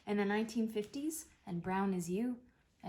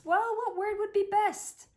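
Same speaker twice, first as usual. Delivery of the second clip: exasperated; 12 semitones higher, 7 dB louder than usual. Real, not acted. Frustrated plea during rehearsal.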